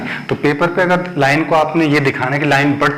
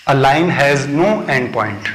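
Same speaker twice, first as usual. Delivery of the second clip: slow dictation; much slower than usual. Shown as a demonstration. Dictating a definition.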